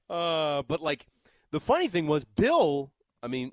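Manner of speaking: ordinary talk, nothing marked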